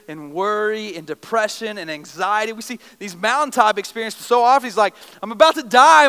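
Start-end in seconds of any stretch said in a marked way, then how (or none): 5.2-6.1 loud, high burst of panic; panicked cry. Retold or imitated. David crying out in fear, loud panicked outburst. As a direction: cry out like death is near.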